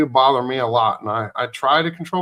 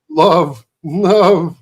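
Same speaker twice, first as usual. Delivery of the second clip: loud cheerful Ron; 3 semitones higher, 7 dB louder than usual. Retold or imitated. Ron laughs off the slap.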